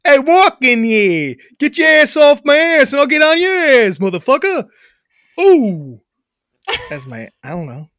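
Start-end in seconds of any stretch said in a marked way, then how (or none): 0.0-6.0 loud, hammed-up New York tough talk; brash mock New York accent. Shown as a demonstration. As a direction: play a mouthy New Yorker, pitched up. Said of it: "a New York accent".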